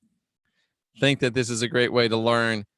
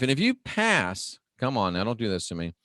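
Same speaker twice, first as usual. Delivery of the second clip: ordinary talk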